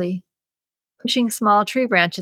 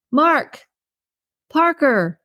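higher-pitched call to two names; yelling; 7 semitones higher, much slower than usual. A narrator's reading. Maggie yells to Mark and Parker after finding the baggie, yelling. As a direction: call out as if summoning them, higher. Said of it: "Maggie yelled".

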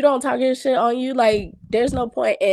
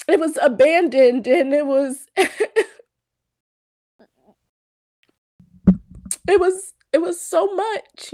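choked up; 3 semitones higher than usual. Real, not acted. Emotional moment, laughing through tears.

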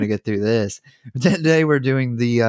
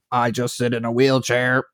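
Stephen Hawking voice. Retold or imitated. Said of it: "would you practice Stephen Hawking".

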